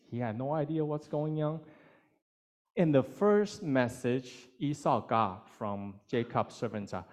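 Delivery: ordinary talk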